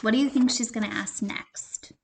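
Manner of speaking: usual speaking voice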